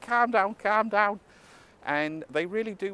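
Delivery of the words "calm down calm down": put-on higher, louder Liverpool catchphrase in a Scouse accent; a cheeky put-on Scouse "Calm down, calm down". Vlogger mimicking Liverpool locals, Scouse accent. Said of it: "they really do say things like".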